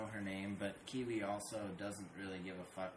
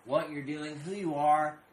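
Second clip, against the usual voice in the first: loud, raised, slow emphatic rant; exasperated insistence; 7 semitones higher, 10 dB louder, slower than usual. Retold or imitated. Telling her the cat doesn't care, loud exasperation. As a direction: hammer each phrase home loudly.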